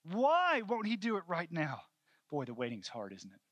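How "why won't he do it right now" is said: high, loud, slow lament; impassioned preacher's cry of impatience; anguished plea. Real, not acted. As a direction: cry out the question, drawn out. Preacher crying out his frustration.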